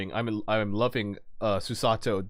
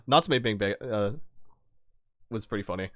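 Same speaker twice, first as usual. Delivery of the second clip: normal talking voice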